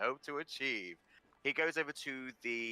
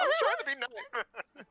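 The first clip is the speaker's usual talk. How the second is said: defensive squeak; 5 dB louder than usual. Retold or imitated. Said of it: "I was like".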